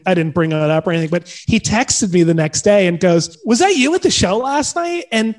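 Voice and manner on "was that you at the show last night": high-pitched imitated disbelief; retold surprised question. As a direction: ask it high, taken aback. Retold text, incredulous question.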